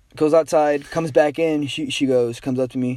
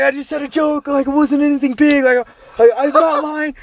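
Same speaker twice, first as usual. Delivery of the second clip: panicked pleading; 9 semitones higher, 3 dB louder than usual. Retold or imitated. Panicked pleading to angry dad.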